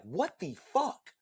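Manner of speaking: slow, high, stunned disbelief; slow disbelieving what. Retold or imitated. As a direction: let the shock drag out. Shock at the text.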